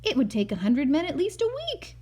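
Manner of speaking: a touch of a skeptical official; doubting Henry. Acted. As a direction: tell him it can't be done. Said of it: "said Henry B. Swap".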